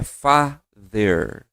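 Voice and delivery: "father" stretched very slowly, th near a d. Shown as a demonstration. Teacher corrects fater, slowly modelling father. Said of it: "não é fater".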